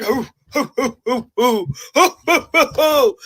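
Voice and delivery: high, loud mimicking hoots; hammed-up whooping cries; mock hooting. Mimicking the chain-grab moment.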